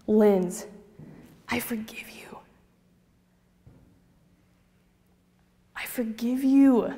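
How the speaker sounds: forgive her gently, slowly; sincere forgiveness. Retold or imitated. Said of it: "in all sincerity".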